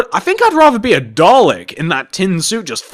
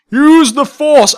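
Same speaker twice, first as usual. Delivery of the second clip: movie quote impression; 7 semitones higher, 4 dB louder, slower than usual. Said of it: "I'd rather speak in movie quotes like".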